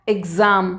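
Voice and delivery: "एग्जाम" with the X voiced as g-z; GZ sound. Shown as a demonstration. Teacher showing how X changed, GZ sound in "एग्जाम". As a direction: say "एग्जाम", letting X become "gz". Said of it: "अब देखिए वही एक्स क्या हो गया".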